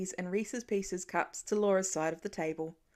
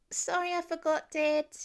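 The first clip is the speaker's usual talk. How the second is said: put-on higher, small child's sorry; apologetic little boy; 7 semitones higher than usual. A narrator's reading. Little boy apologizing to his dad, high and sheepish. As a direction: say it like a sheepish kid.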